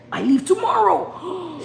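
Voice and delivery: high, slow, drawn-out wail; mock panicked cry. Streamer voicing friend's message before the trip, mock panicked cry. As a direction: cry it out high, stretching the words.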